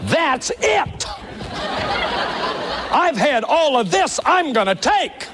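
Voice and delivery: high mock outburst, fed up and half laughing. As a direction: blow up like someone whose sack is finally full. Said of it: "They say".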